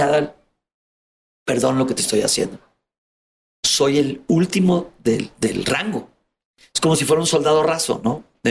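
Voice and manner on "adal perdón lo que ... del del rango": remorseful captor. Retold or imitated. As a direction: apologize in his low, sorry tone. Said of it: "Y me dice". (un secuestrador arrepentido, voz apenas más grave)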